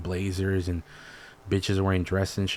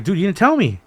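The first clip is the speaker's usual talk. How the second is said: high, louder indignant protest; retold protest; 10 semitones higher, 7 dB louder than usual. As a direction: complain to the dad, pitched up and loud.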